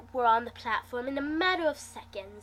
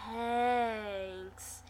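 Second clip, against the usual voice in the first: sarcastic; much slower than usual. Acted. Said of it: "his voice dropping in sarcasm".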